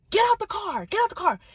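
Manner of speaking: high, loud angry command; rowdy yelling. Retold or imitated. Woman ordering the man out of the car, rowdy yell. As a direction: yell the order, pitch jumping up. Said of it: "then she gets rowdy".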